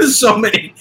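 high, loud words through laughter; helpless real laughter in the voice; laughing. Real, not acted. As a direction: crack up while repeating it. Cracking up at a friend's remark.

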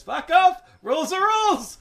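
high, loud shouted protest; angry shout. Retold or imitated. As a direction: yell it like a sore loser. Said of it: "He goes".

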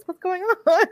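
laughing disbelief. Real, not acted.

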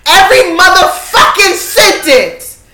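loud, high exasperated outburst; high-pitched, loud pet-peeve venting; annoyed rant. Real, not acted. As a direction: rant in real annoyance, words stretched out. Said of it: "fucking annoying".

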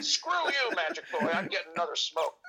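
high-pitched cranky brush-off, laughing at the end; mock defiant ghost. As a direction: tell them off as a grumpy ghost, hammed up. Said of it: "sticking out the middle finger and going".

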